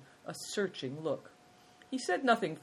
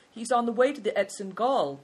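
louder, higher proclamation; announcing; 7 semitones higher, 7 dB louder than usual. A narrator's reading. Big Tiger tells his host of the journey, loud high announcement. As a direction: announce it clearly, raised in pitch and volume. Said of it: "Big Tiger announced".

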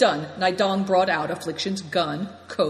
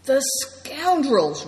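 slow, drawn-out furious outburst, much higher; raging; 8 semitones higher, 5 dB louder, much slower than usual. A narrator's reading. The elder finding his camp looted, raging. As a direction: spit the word out in rage. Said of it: "raged Good Fortune".

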